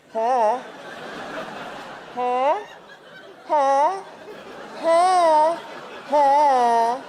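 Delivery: escalating teasing huh; teasing taunt. Retold or imitated. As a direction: dangle the bait, taunting like a street hooker. Teasing bear with marshmallow.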